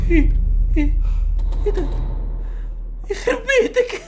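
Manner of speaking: high shrieking curse, half laugh half cry; startled shriek. Real, not acted. Gamer hit by a jump scare, high shriek with a nervous laugh. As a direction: jump out of your skin mid-word.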